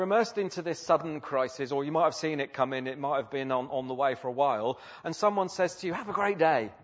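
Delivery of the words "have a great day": higher, louder breezy send-off; put-on bright farewell; mock cheery. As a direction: chirp it obliviously at someone in crisis. Imagined breezy farewell after a crisis.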